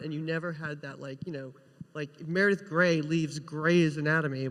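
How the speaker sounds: ordinary talk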